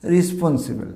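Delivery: রেসপন্সিবল as "ri-spon-si-bel"; corrected pronunciation. Shown as a demonstration. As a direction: model রেসপন্সিবল with "ri" and "si". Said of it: "তাহলে উচ্চারণটা কী বললাম আমরা?". (সঠিক রেসপন্সিবল, "রি" আর "সি" দিয়ে)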